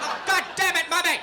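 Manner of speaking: hammed-up high, loud yell; outraged child. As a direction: explode at mum in mock outrage. Child outraged at mother's admission.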